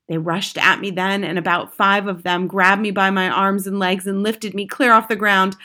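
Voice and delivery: usual speaking voice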